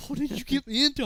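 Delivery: mock alarmed recruit; acted worried buddy. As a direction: complain to the friend responsible. Buddies complain in story.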